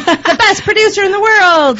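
laughing hype for the producer. Real, not acted. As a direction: say it through a laugh.

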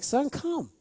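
high, soft, gentle plea from a father; pleading father. Retold or imitated. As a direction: coax the son in softly, as a pleading father. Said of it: "He pleaded".

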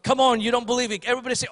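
ordinary talk

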